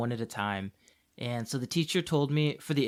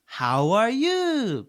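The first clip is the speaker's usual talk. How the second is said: put-on very slow, high, loud Japanese-accented English; exaggerated Japanese accent; 8 semitones higher, 5 dB louder, much slower than usual. Teacher showing what the kid understands, exaggerated Japanese accent. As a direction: drag it out in a hammed-up Japanese accent. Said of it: "you have to exaggerate it a lot".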